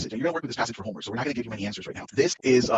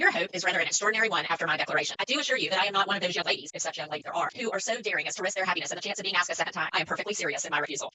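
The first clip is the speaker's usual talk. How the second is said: higher, steady, firm refusal; reading Elizabeth; 7 semitones higher than usual. Acted. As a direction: insist you are perfectly serious. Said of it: "then I'll jump in for her".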